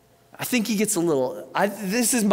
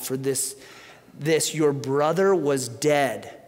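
gentle father; 5 semitones lower, 4 dB quieter than usual. Acted. Father answers his son gently.

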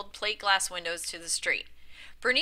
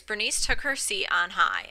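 ordinary delivery

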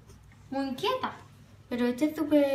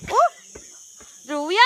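surprised yelp; 6 semitones higher, 9 dB louder, much slower than usual. Real, not acted. Ball hits her, calls sister.

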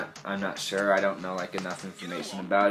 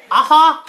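very high, loud "aha"; shrieky comic cackle; mock laugh; 14 dB louder than usual. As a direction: yelp out a fake laugh. Mock laugh ending accent bit.

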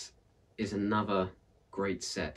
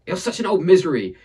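loud, higher exasperated cry; cried out; 9 semitones higher, 11 dB louder than usual. Acted. Villager crying out at Aunt Misery, loud exasperated. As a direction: hurl it at her. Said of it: "cried one of the villagers".

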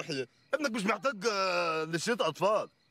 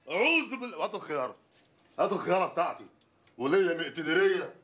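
ordinary delivery

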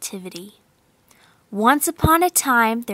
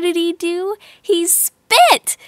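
excited; 6 semitones higher, slower than usual. A narrator's reading. Storyteller building suspense.

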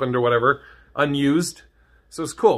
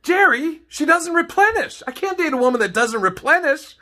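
much higher whiny sitcom complaint to Jerry; a Seinfeld-style rant to Jerry, much higher; Seinfeld character; 13 semitones higher than usual. Retold or imitated. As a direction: whine it like a Seinfeld bit. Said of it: "sounds like a Seinfeld episode".

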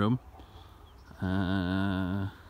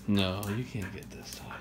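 ordinary delivery

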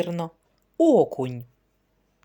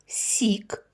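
сік in a secret whisper; whispered word. Shown as a demonstration. Teacher hiding a word from children, whispered. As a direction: whisper сік like a hidden clue. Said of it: "Слухайте".

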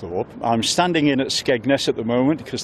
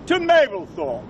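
town crier; 13 semitones higher, slower than usual. Real, not acted. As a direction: proclaim it to the town. Town crier closing his cry.

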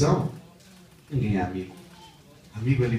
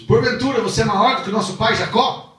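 high, loud scornful question; Samaritan woman's challenge; 10 semitones higher, 9 dB louder than usual. Acted. Preacher voicing the woman challenging Jesus, scornful and loud. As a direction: fling the question at him. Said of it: "Ela fala pra Jesus".

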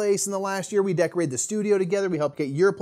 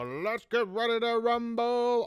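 high, slow ring announcer call; boxing announcer; 6 semitones higher, 4 dB quieter, slower than usual. Retold or imitated. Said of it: "like one of those old-timey boxing announcers. They go".

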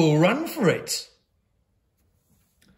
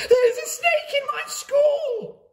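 shrieking headmistress, much higher and louder; shouted; 5 dB louder than usual. A narrator's reading. Headmistress storming the playground, shrieking in outrage. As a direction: cry it out in outrage. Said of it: "she shouted".

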